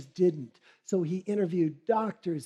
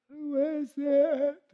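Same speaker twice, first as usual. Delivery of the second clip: put-on old woman calling out; 8 semitones higher, much slower than usual. Sick woman calls through door.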